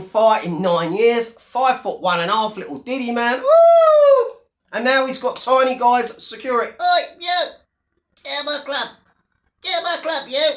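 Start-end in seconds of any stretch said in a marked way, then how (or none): 3.4-4.3 a high, loud mock whoop; mock cheer. Mocking the fighter with a high, loud whoop. 6.7-10.5 high, squeaky mock bouncer shouting. Mocking the small security guys, high mock bouncer yell.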